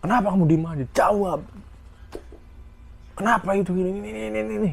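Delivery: high demanding scold; scolding senior. Retold or imitated. Senior demanding an answer from a silent junior, high scolding.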